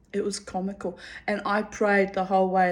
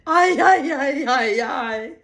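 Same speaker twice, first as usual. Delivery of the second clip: exasperated cry; 5 semitones higher, 6 dB louder, slower than usual. Real, not acted. Exasperated exclamation.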